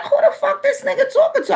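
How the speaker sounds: squeaky high outraged question; high-pitched indignant retort; outraged disbelief. Retold or imitated. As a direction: shriek it in insulted disbelief.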